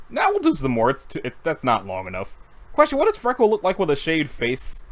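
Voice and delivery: normal talking voice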